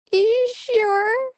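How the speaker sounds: very high, held-out falsetto; squeaky, sing-song mock concession; playful high voice. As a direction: draw it out way up high. Playful answer to own question.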